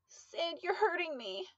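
high, choked plea under a grip; strained plea. A narrator's reading. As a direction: force it out while being choked. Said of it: "she mustered".

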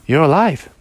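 startled gasp of discovery; boy shocked the toy speaks, surprised cry. Acted.

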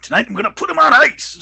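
high, booming mock wrestler shout; Hawk's promo yell. Host imitating wrestler's quick closing threat, yelling. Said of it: "Hawk just came in with the quick".

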